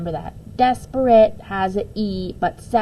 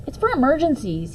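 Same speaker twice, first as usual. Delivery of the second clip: defensive; 6 semitones higher than usual. A narrator's reading. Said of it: "he said defensively".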